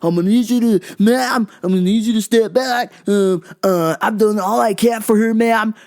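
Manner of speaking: an EMT putting on a firm, official tone; defensive EMT. Retold or imitated. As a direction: hold the mom back with a lightly voiced official tone. EMT answering the angry mom.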